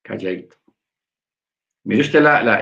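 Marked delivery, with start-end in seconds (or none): 0.0-0.6 very soft, low-pitched hush. Shown as a demonstration. Preacher urging brethren to keep silent, hushed. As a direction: drop right down, keep it little and quiet. Urges silence, drops voice to match.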